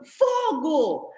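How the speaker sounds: loud, high cry of alarm; yelled out like a passer-by raising the alarm; alarmed shout. Acted. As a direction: shout a sudden fire warning. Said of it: "alguém passa gritando aqui atrás".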